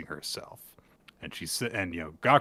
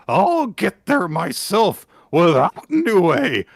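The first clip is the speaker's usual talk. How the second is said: high, louder, slow vow; determined Gakuro; 11 semitones higher, 6 dB louder, slower than usual. Acted. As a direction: swear it with trembling resolve. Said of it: "and he says".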